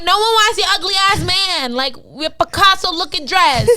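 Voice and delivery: put-on telling the girl off with attitude; retold insult. Girl telling off a stranger at club, loud and sassy. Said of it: "So I'm like".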